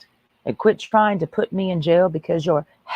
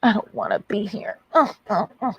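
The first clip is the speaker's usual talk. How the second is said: mock pouty whine; 7 semitones higher, 4 dB quieter than usual. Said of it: "pitching a temper tantrum".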